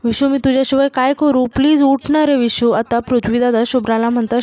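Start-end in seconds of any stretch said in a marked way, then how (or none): none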